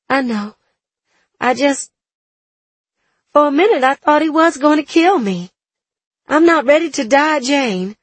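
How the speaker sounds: upset woman, close to tears; shaken young woman. A narrator's reading. Catherine shaken after near death.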